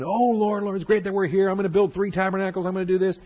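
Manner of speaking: high, fast, gushing Peter voice; eager Peter. Retold or imitated. Preacher voicing Peter gushing to the Lord, high and fast. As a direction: bubble over like an eager disciple. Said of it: "Peter starts running up".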